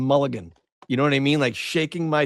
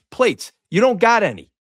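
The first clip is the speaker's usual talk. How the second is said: exasperated customer rant; 8 semitones higher, faster than usual. Retold or imitated. Angry customer complains about plates.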